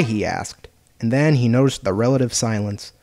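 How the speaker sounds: ordinary delivery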